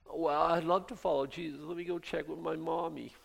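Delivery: high, mocking voice of a timid follower; mock the one who has to ask mommy first; mocking a timid believer. Mocking a half-hearted believer.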